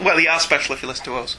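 ordinary delivery

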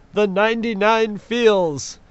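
high, loud, slow proclamation; big drawn-out dramatic emphasis; hammed-up exclamation. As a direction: belt it out with mock grandeur. Hammed-up emphasis while reading feedback.

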